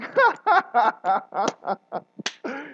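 hearty laugh. Retold or imitated. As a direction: burst out laughing. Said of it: "Police sergeant's laughing".

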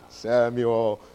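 low, drawn-out booming God calling a name. Acted. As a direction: intone the name slowly and deep. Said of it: "That's my God voice".

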